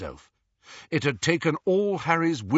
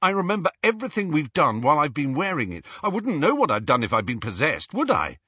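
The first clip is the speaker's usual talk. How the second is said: higher, indignant self-justification; defensive; 6 semitones higher, 4 dB louder, faster than usual. A narrator's reading. Harry insisting he is not possessed, defensive. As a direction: argue back hotly. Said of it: "he said defensively".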